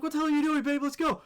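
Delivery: high, incredulous hurry-up; retold urging. Urging his frozen girlfriend out.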